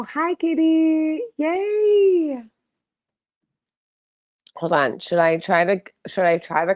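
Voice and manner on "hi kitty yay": very high, slow pet greeting; cooing hello. Real, not acted. Owner greeting her kitty, high slow squealing coo.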